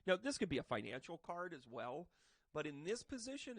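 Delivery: ordinary talk, nothing marked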